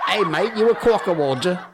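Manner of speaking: hammed-up Aussie, pitched high; terrible Australian accent. Host jokes that the word sounds Australian, terrible Australian accent. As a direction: badly fake an Australian accent, laughing into it. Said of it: "That was a terrible Australian accent".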